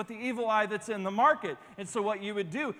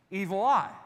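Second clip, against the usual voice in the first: broken English vendor. Retold or imitated. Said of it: "it would say".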